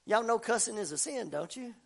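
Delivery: usual speaking voice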